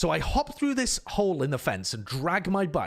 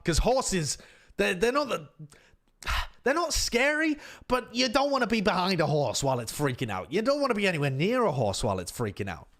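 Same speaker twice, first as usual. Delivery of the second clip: plain reading voice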